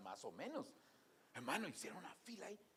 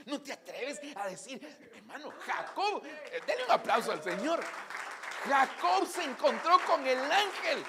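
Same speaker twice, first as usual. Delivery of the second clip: preaching at full force; 9 semitones higher, 14 dB louder than usual. Real, not acted. Preacher calling the church to applaud, at full force. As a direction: proclaim it to the whole church.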